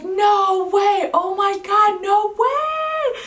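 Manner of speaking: rising mock scream at astonishing news; retold scream. Mother re-enacting hearing she has twins, screaming. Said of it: "And I started screaming".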